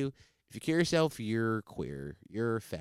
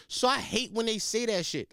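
normal talking voice

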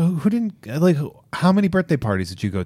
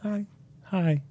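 higher, soft, strange greeting voice; odd little voice; 8 semitones higher, 8 dB quieter than usual. Pretend. Host asks why he always greets this way, a quiet odd voice. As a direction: say hi in your weird podcast voice. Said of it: "Why do you talk like that".

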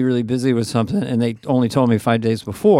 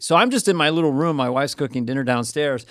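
usual speaking voice

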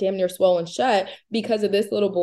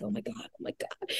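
breathless whispered panic under the breath; panicked whisper; 17 dB quieter than usual. Retold or imitated. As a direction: whisper it so nobody at the table hears. Holding back coughs at restaurant.

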